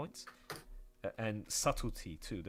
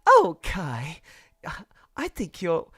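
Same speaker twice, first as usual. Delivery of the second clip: higher, louder, slow gushing put-on voice; mock sweet voice; 8 semitones higher, 9 dB louder, slower than usual. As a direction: flatter Kai sweetly. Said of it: "I'm like".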